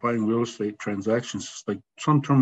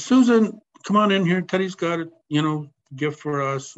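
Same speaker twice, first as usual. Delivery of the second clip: calling out; 5 semitones higher than usual. Retold or imitated. Man calling his wife Susan to come see a gift, calling out. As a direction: call your wife in from another room.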